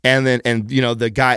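usual speaking voice

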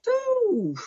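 high, drawn-out "ooh" while reckoning; soft high exhaled ooh; long pondering ooh. Real, not acted. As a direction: let out a long, thoughtful ooh. Estimating how many years.

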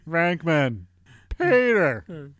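high, falling mock calls; calling the name. Host chanting cartoon character's name, mock call. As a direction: call out each name separately.

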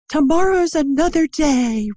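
hammed-up classic movie line, lifted high; Scarlet. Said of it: "Scarlet says that".

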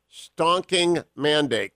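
"stonking mandate" read out very slowly; slow, relishing the quote; quoted phrase. Shown as a demonstration. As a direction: drag out the quoted words deliberately.

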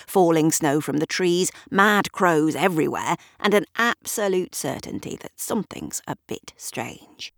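usual speaking voice

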